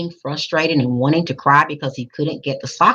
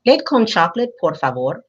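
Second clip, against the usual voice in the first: Spanish whisper; 4 semitones higher than usual. A narrator's reading. Hushed Spanish request. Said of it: "Gabe whispered to the lunch aide".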